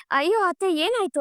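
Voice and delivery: high-pitched, lightly acted alarm. Daughter-in-law runs up to mother-in-law's call, alarmed. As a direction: pitch jumps up in flustered concern.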